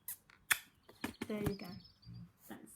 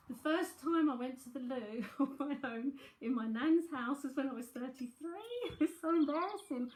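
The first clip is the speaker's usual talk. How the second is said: embarrassed laughter; 3 semitones higher, 5 dB louder than usual. Real, not acted. Medium confessing childhood fear to viewers, embarrassed laughter. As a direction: laugh at your own embarrassment.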